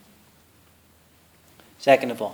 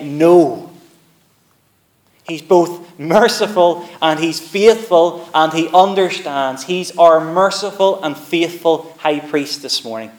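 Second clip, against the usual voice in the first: preaching at full force; 5 semitones higher, 8 dB louder than usual. Acted. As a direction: drive it home to the congregation. Sermon climbs to full preaching.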